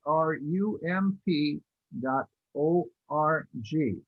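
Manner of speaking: slow, letter by letter with pauses; slow spelling-out. Real, not acted. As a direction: spell it out slowly for listeners to note down. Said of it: "I'll repeat that slowly".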